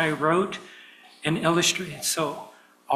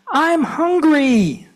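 put-on high, slow, small child's whine; little cub voice; 12 semitones higher, 9 dB louder, much slower than usual. Baby Bear wakes his parents, whining in a small high voice. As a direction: stretch it out like a tiny hungry bear. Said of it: "He's saying".

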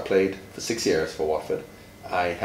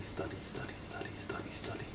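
study, study whispered, fading away; whispered repetition; 13 dB quieter than usual. Shown as a demonstration. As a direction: whisper the drill softer and softer. Repetition drilled for a child.

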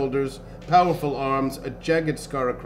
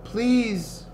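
higher, lightly voiced princess; princess calling; 7 semitones higher than usual. A narrator's reading. Princess calls into the potion shop, pleading. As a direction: call in politely, pitch raised. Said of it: "she called into the potion shop".

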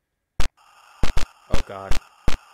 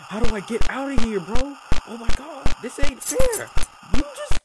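frantic pleading as he's cornered; panicked high whine; 12 semitones higher than usual. Real, not acted. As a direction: pitch climbing into a frantic squeal. Chased, voice rising in panic.

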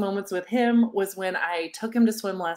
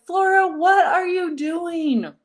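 self-scolding inner voice; 6 semitones higher, 4 dB louder than usual. Retold or imitated. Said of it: "some aha came up like".

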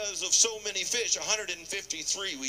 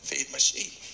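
gentle command; 3 semitones lower than usual. Acted. Jesus voiced gently.

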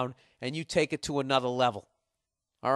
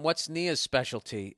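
normal talking voice

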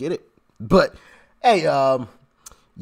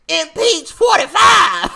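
higher, louder, slow drawn-out yell; mock rally shout; 11 semitones higher, 7 dB louder than usual. Vlogger mocks Maxine Waters, bellowing it slowly. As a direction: stretch the shout like a crowd chant. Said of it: "I hate this lady's voice".

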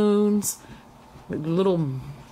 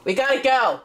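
raised voice calling upstairs; yelling across the house; 5 semitones higher, slower than usual. Real, not acted. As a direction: call up to him firmly. Calling son from downstairs.

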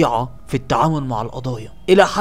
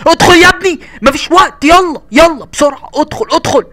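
urgent shouting; 13 dB louder than usual. Acted. Shouting to hurry inside.

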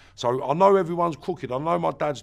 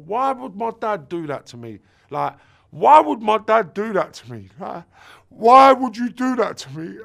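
put-on sobbing child; 6 semitones higher, 8 dB louder than usual. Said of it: "I cried and I sobbed".

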